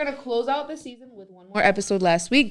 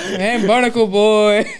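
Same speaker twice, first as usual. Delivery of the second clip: cartoon announcer; 2 semitones higher, 4 dB louder, slower than usual. Mimicking SpongeBob announcer.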